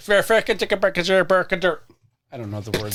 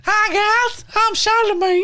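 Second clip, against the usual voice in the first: high silly character voice; Charlemagne voice; 3 dB louder, slower than usual. Pretend. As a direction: play Charlemagne goofy and high. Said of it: "now enter Charlemagne".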